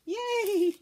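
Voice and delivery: high, loud delighted cheer; little burst of joy; excited cheer. Real, not acted. Fabric peeled off nicely.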